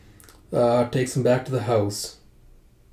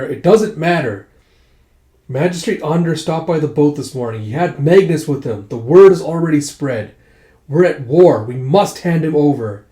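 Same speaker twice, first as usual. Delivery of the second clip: loud, forceful, raised-pitch arguing; urgent insistence; 3 semitones higher, 8 dB louder than usual. Acted. Family member arguing at the table to surrender him, urgent and loud. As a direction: press the case hard and loudly.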